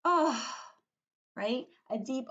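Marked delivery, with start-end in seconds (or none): 0.0-0.6 voiced "oh" sigh, higher and louder; big demonstrative exhale on "oh". Shown as a demonstration. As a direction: sigh it out audibly. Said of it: "when you sigh, you're like".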